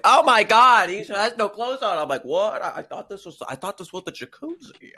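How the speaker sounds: hammed-up shocked gasp, then feigned confusion; mock beachgoers. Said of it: "be like".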